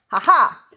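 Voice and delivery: loud, high crowing laugh; triumphant "ha ha". Real, not acted. Baker hits twelve on the scale, triumphant laugh. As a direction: crow a victorious two-note laugh.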